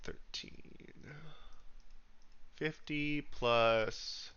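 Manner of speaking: quietly adding up under the breath; muttering sums. Real, not acted. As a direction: count it out softly to yourself. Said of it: "let me add this up".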